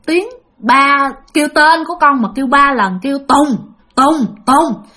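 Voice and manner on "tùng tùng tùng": mock loud call of the name, a little higher; calling loudly. Mother calling her daughter's possessing spirit, mock loud call. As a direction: call the name out three times. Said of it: "mà kêu lớn lên".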